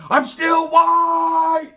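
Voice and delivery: long, high shout stretched out; drawn-out comic scream; retold mid-air yell. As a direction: holler it like you're flying through the air. Retold flying man's cry.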